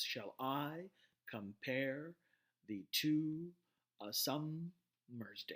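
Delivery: "Shall I / compare / the two / a summer's day" in separate pairs, second syllable stressed; iambic beat by beat. Shown as a demonstration. Lecturer demonstrating how the line sounds, stressed iamb by iamb. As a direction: pause after each beat, leaning on "I", "pare", "two", "sum", "day". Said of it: "it sounds basically like this".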